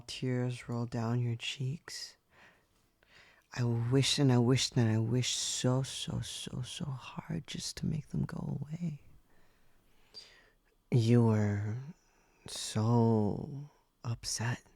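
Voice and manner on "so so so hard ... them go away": soft fading whisper. Acted. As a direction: let the wish fall into a whisper. Remembering master's tears.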